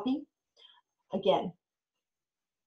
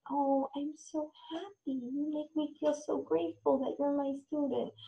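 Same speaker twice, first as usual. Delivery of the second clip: teacher telling a student she is grateful, soft; grateful, tender; 5 semitones higher, 6 dB quieter than usual. Shown as a demonstration. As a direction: tell them gently how grateful you are. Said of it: "not touching ways".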